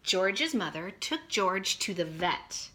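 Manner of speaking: slow, lively picture-book reading; reading to children. A narrator's reading. Teacher reading a picture book to her class, slow and lively. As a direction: read it out big for kindergartners.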